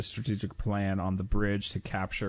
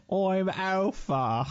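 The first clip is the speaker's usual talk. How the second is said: hammed-up Southern accent, much higher and slow; mock Alpha accent; 10 semitones higher, 3 dB louder, much slower than usual. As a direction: drag out a fake Southern accent, high and slow. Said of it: "Cockney British accent".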